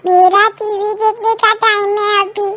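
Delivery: put-on a sulky boy sulking for his TV time; whiny child. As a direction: son complaining to father. Child whines about TV.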